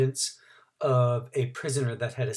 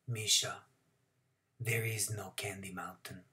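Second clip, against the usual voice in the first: sad whisper; 4 semitones lower, 11 dB quieter than usual. A narrator's reading. Father answers son sadly.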